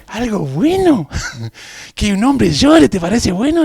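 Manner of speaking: high, loud, drawn-out disbelief; incredulous. Retold or imitated. Confused man challenging his laughing instructor, incredulous. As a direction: retort in indignant disbelief, stretching the key words. Acted indignant reply to instructor.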